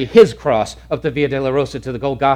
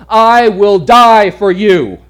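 loud, slow, higher proclamation; proclaimed loudly; 6 semitones higher, 9 dB louder, much slower than usual. Acted. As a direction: preach it out to the hall. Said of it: "sermon climax repeat".

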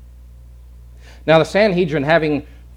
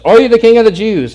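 loud, raised-pitch official's question; questioning Pilate; 8 semitones higher, 11 dB louder than usual. Acted. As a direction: question the prisoner in a loud, high voice. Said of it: "Pilate says".

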